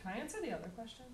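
off-mic and distant; speaking away from the microphone; turned away from mic. Real, not acted. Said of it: "can you face the mic towards your mouth and not me".

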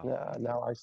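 normal talking voice

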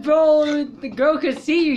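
urgent warning. Retold or imitated. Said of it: "I'm like".

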